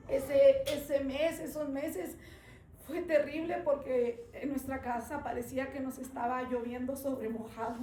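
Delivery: ordinary talk, nothing marked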